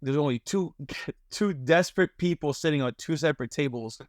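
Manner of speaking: normal talking voice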